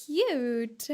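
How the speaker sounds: normal talking voice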